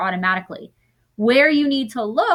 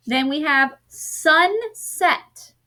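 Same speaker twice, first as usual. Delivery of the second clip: Then we have sunset said slowly and higher; slow, drawn-out announcing; 6 semitones higher, much slower than usual. Shown as a demonstration. Teacher presenting the next word, slow and lifted. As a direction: stretch out the new word for the class.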